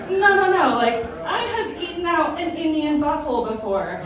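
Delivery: mock-defensive "no, no, no" while laughing; laughing protest. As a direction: protest through laughter, half joking. Defending herself to friends.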